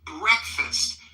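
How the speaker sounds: Breakfast said as two syllables, stress on BREAK; Breakfast as BREK-fust, stress on the first syllable; clear breakfast. Shown as a demonstration. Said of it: "We say it as breakfast".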